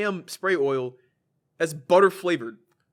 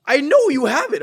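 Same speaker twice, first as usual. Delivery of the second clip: loud, high mock yell; hammed-up shouted insistence; imitated angry accusation; 8 semitones higher, 10 dB louder than usual. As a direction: accuse the clerk as the irate old man. Customer repeats angry accusation.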